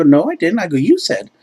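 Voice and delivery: high, loud mock protest; playful re-enacted quarrel; acted arguing. Acted quarrel over paying.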